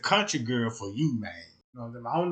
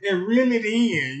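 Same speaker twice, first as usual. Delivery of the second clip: higher, louder coaching call; coaching girlfriend; 5 semitones higher, 5 dB louder than usual. Retold or imitated. Girlfriend coaching him to cast, higher and louder. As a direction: call out instructions like a fishing coach. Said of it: "she coaching me".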